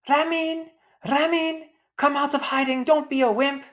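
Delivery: a high shouted taunt across the street; calling out. Acted. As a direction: kids daring a boy to come out and fight, loud taunting call. Kids calling a boy out to fight.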